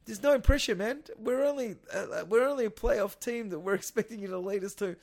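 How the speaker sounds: ordinary talk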